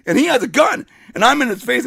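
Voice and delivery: ordinary talk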